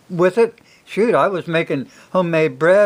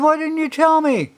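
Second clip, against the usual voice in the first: dismayed dad; 11 semitones higher than usual. Retold or imitated. Dad confronts crying kids.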